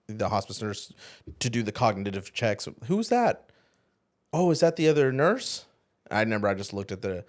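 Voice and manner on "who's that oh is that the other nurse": higher-pitched, lightly voiced puzzled question; a hint of a confused patient; puzzled wife. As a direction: imitate her innocent not-knowing. Confused wife voiced, not recognizing him.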